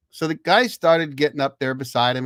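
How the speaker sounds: ordinary talk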